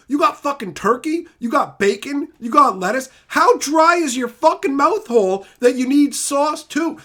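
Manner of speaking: heated scolding; angry rant. Real, not acted. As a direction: berate them loudly. Continued ranting at chat.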